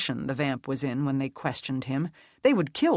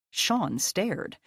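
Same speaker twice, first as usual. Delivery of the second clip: ordinary delivery, nothing marked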